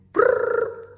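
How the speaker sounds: rolled-lip ringing buzz; phone ring imitation.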